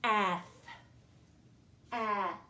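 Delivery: ath said alone as a short a and th; first syllable sound. Shown as a demonstration. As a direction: isolate ath for the children. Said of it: "The first syllable is ath".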